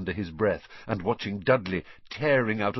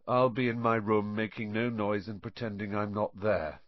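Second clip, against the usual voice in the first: ordinary delivery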